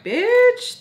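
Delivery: high, loud, drawn-out "Bitch"; sudden loud retort. Retold or imitated. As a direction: fire it back at them, slow and loud. Her retort to being called weirdo.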